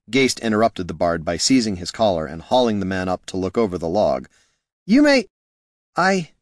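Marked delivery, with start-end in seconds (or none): 4.8-6.3 high start, trailing off; high, faltering, abandoned retort; voice falling away. A narrator's reading. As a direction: break off and lose the words. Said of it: "Heraldon's voice fell away".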